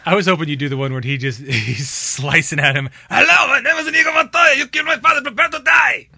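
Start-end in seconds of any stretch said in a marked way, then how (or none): 3.1-6.1 high, loud, hammed-up Spanish accent shout; terrible Spanish accent. Friend imitating Inigo Montoya's movie line, hammed-up Spanish accent shout. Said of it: "It was a terrible Spanish accent".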